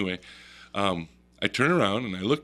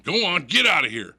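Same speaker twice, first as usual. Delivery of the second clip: loud, higher commanding holler; acted-out forceful shoo; imitated friend shooing bear; 8 semitones higher, 6 dB louder than usual. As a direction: order it off like a dog. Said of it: "he points to the other direction".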